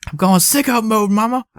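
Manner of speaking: high-pitched hammed-up boast; excited son impression. Host mimics film son to his mom, excited high voice. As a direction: imitate an excited boy announcing himself to mama.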